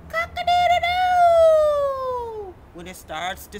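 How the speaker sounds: very high, loud, slow cock-a-doodle-doo; rooster crow. Acted. The rooster crowing to the listeners, loud high crow.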